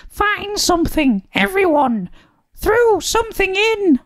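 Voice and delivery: very high, gleeful witch voice; grinning witch. A narrator's reading. Witch urging friends to fill the cauldron, grinning. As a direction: call it out high through a grin. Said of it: "said with a grin".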